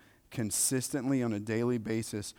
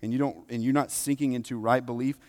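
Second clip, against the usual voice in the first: loud, raised, driving preacher delivery; impassioned preaching; 5 semitones higher, 10 dB louder than usual. Real, not acted. Preacher to congregation on coming to Scripture in faith, impassioned preaching. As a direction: preach it with force, pitch up and loud.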